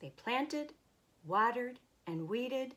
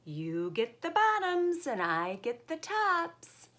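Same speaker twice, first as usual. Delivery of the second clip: high call to wake Bear; 10 semitones higher, 5 dB louder than usual. Acted. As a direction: sing it out to a sleeper. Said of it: "Hare called out".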